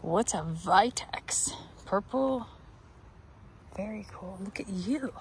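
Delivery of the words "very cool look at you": hushed whisper to a flower. Real, not acted. Cooing at a plant she admires, whispered. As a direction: breathe it softly at the bloom.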